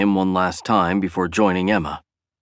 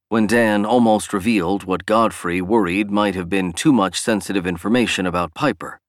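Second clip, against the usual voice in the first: ordinary delivery, nothing marked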